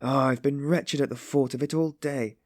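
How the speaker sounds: high, wretched self-reproach; pained remembering. A narrator's reading. Sonia remembers bringing her to tears, wretched. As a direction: blame yourself, pained and agitated. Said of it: "at the pain of remembering it".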